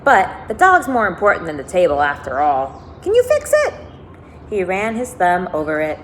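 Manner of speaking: lively read-aloud narration; expressive storybook reading with lifted quotes; story reading. A narrator's reading. Reads novel aloud to listeners.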